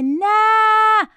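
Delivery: high-pitched whining protest; shrieking child. A narrator's reading. Boy protesting to his mother about waiting, shrieking. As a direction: play a screechy boy refusing to wait.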